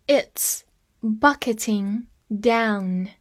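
slow, stretched repeat; teacher repeating slowly. Shown as a demonstration. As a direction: say "It's bucketing down" slowly with a pause.

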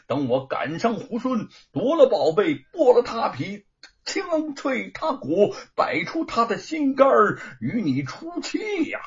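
vengeful threat. Acted. Bull King vows revenge.

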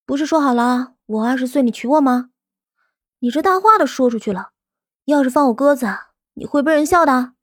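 high, pouting playful plea; coquettish. A narrator's reading. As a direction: sweetly sulk at her man. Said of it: "有些撒娇地说道".

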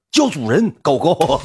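high, loud imitated order; mock master's command. Donor suddenly demands to be called master, mock loud command. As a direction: call out commandingly, pitched high like calling a dog. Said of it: "别瞬间来了一句叫主人".

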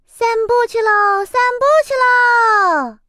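high, bouncy sing-song call; child voice chanting let's go; excited little chick. A narrator's reading. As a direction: cheer like a happy kid off on a walk. Chick cheering for a walk.

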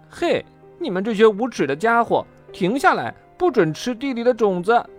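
high, loud angry shout; bossy, high-pitched telling-off; indignant scolding. A narrator's reading. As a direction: yell at the birds to stop. Scolding birds from the window.

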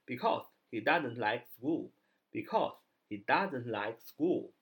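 slow careful English; slow classroom demonstration; slow model reading. Shown as a demonstration. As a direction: spell it out slowly for learners. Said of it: "因为他不喜欢上学".

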